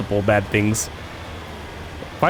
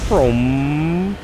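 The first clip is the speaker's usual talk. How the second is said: higher, long vroom rising at the end; imitated engine vroom; 4 semitones higher than usual. Player heading to the hideout, rising playful vroom. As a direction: rev up by mouth. Voices the vehicle setting off.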